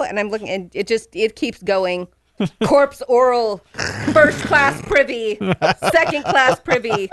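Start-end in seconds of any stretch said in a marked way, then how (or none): 2.7-7.1 mock military reporting in a raised voice; tiny army roll-call. Fan acts out Toot Toot's crew reporting, voices pitched up. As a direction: imitate little soldiers announcing themselves, pitched up. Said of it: "voices kept going higher in pitch".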